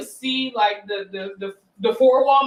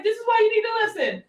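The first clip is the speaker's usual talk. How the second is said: high scolding mother voice; mom lecturing; 11 semitones higher than usual. Retold or imitated. Mother telling her son off, high. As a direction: wag a finger up high. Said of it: "she was like".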